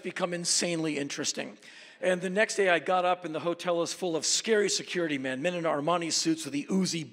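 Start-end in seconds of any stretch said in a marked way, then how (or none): none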